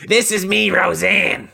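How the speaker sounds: high, badly faked sitcom-mom voice; hammed-up, way-off impression; Roseanne. Comic insisting to cohost he is Roseanne, high and bad. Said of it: "That's not even close to Roseanne".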